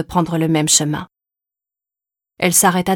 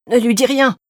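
pleading; 5 semitones higher, 4 dB louder than usual. A narrator's reading. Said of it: "supplia Sylvain".

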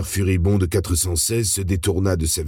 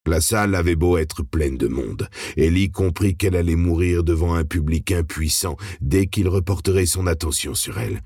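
usual speaking voice

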